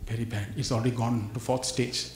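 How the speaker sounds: doctor's hushed verdict; hushed grave news. Retold or imitated.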